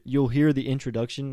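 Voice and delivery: normal talking voice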